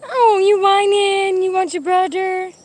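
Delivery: high, drawn-out doggy coo. Real, not acted. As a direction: croon slowly up high to a whining pup. Cooing to a whining dog.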